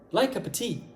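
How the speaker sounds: "like" strong, "of" swallowed, "tea" falling; English rhythm. Shown as a demonstration. Teacher repeats the short model, stressed "like cup tea", "of" reduced. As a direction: glide "cup of tea" into one phrase. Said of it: "la rythmique, c'est le fait de faire".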